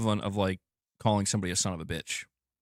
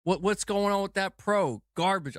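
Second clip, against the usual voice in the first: mock outrage; 7 semitones higher than usual.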